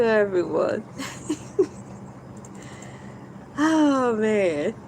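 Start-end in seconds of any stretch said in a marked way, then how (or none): 0.9-2.0 soft laugh. Real, not acted.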